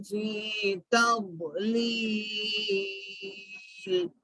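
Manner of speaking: slow, measured tajweed chanting; Quran recitation. Shown as a demonstration. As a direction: draw out the long vowels, fading on the last. Recites verses from the start.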